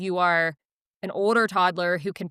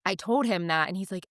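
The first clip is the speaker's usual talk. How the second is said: ordinary talk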